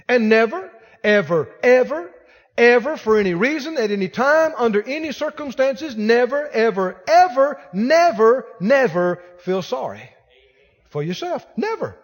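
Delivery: loud hammered nevers from the pulpit; fiery sermon emphasis; preaching at full force. Acted. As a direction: pound every never like a drumbeat.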